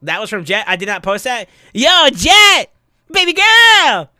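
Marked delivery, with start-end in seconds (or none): 1.7-4.1 loud high hype call to a donor; excited shout. Real, not acted. Streamer hyping a donor loudly.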